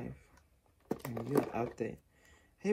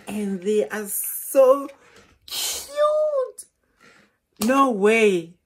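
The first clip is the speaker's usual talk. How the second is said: slow build to a high squeal on cute; gushing squeal; 6 semitones higher, 11 dB louder, much slower than usual. Real, not acted. Vlogger gushing to viewers over headphones.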